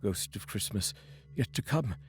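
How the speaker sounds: fearful Scrooge. Acted. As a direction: ask the silent ghost with dread. Scrooge questions the ghost.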